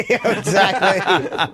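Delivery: drawn-out agreement, laughing along. Real, not acted. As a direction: agree through real laughter. Laughing at a crude joke.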